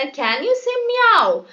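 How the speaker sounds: high-pitched puppy question; puppy asking. Acted. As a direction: ask it like a small eager puppy. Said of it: "നമ്മുടെ puppy ചോദിക്കുകയാണ്".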